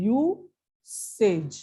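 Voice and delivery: usage split as "yoo... sej", drawn out; sounding out syllables. Shown as a demonstration. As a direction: read usage slowly in two separate parts. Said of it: "ऐसे पढ़ेंगे".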